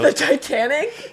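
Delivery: high, slow incredulous question; surprised. Real, not acted. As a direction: ask it in disbelief.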